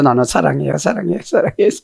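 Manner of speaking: acted cutesy, lilting pleading; dancing aegyo. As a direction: coax like a child dancing for a parent. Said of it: "사랑해도 이게 춤추면서 해요".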